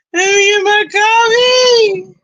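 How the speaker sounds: high, loud, stretched-out singsong; playful drawn-out call. Pretend. Streamer to viewers wanting coffee, playful drawn-out call. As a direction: draw it out high and slow like a coffee plea. Playful repeat of own line.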